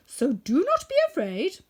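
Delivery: calm the frightened mice; lightly voiced woodman. A narrator's reading. Woodman reassuring the mouse queen, firm.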